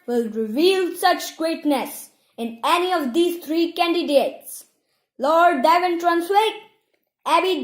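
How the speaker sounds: high, loud herald's proclamation; penetrating voice. A narrator's reading. Isla proclaims the ceremony to the crowd, penetrating and loud. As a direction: ring it out like a town crier. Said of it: "in a penetrating voice".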